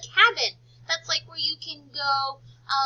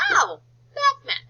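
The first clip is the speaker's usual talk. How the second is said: Oh bath mat, rising high with delight, slower; delighted realisation; 11 semitones higher, 7 dB louder than usual. Shown as a demonstration. Teacher modelling sudden understanding of bath mat, delighted. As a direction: act out the aha moment for the kids. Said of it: "discovering the word".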